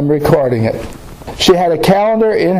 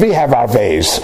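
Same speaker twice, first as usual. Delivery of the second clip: lightly imitated German accent; 5 semitones lower than usual. [name] answering Janet's question about leaving, low German accent. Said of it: "with her German accent".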